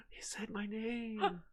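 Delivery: soft, high, slow fan squeal; starstruck gasp. Retold or imitated. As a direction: gasp it in hushed, high awe. Starstruck fan reenacts meeting the actor.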